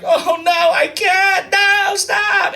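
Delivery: very high, hammed-up pleading wail; mock panic. Client backing out with a sex worker, mock-panicked. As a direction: protest in a squealing falsetto.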